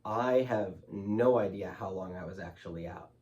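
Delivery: ordinary talk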